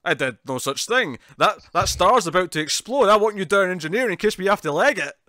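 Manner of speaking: retold stern order. Retelling a captain ordering someone down to engineering, urgent. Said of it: "he's like".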